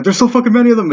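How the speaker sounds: high-pitched acted panic; freaking out. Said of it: "starts fucking freaking out".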